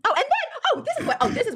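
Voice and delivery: sudden realisation. Real, not acted. Sudden recollection mid-argument.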